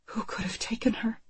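anxious breathless whisper. A narrator's reading. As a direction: whisper it, frightened and secret. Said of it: "Anne whispers to Marco".

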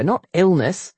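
ordinary delivery

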